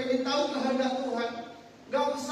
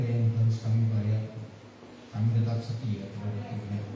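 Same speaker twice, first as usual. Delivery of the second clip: low, hushed praying voice; prayer; 8 semitones lower than usual. Acted. Pastor leads opening prayer.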